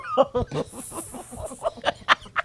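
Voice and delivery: high burst of laughter; real laughter. Real, not acted. Cracking up over her grandfather's remark, real high laughter.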